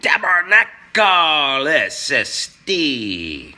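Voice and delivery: put-on French Canadian accent, loud and slow; mock French greeting. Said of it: "Why am I talking in, in French?".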